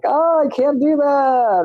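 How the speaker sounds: hammed-up whiny excuse; mock whiny self. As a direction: play your own defeatist whine. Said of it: "stuff that I'm like".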